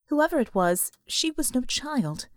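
ordinary delivery

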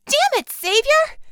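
furious loud high outburst; angry shout. Acted. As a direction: yell it in anger. Bella flushed, angry outburst.

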